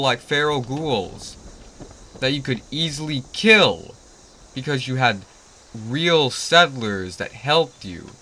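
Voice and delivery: normal talking voice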